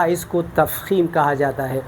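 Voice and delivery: usual speaking voice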